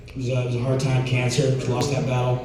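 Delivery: normal talking voice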